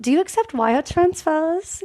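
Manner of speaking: a hint of a breezy rich girl asking; Anna Delvey line. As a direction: toss it off like a rich heiress. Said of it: "the famous line of".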